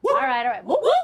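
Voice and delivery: loud whooping hype; hyped-up channel intro; hype cheer. Real, not acted. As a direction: cheer it up for the audience.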